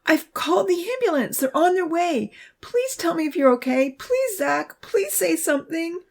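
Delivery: high, urgent begging; high-pitched desperate plea; pleading cry. A narrator's reading. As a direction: beg an injured friend to respond. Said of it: "the voice cried".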